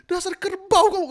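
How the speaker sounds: loud, high strained insult; tense mock insult. Shown as a demonstration. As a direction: spit out the insult with a clenched body. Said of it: "Susah banget gitu ya".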